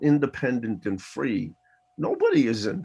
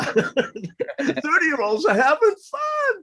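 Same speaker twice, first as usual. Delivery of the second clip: put-on kids' shouted answer; 14 semitones higher, much slower than usual.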